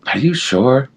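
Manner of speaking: high-pitched, hesitant questioning; retold doubt. Retold doubtful reply to God.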